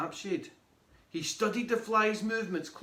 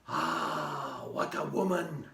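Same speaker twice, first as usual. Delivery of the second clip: low, drawn-out adoring sigh; besotted grandad; 14 semitones lower, slower than usual. Acted. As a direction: sigh it in blissful admiration. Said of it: "Grandad's grin only got bigger".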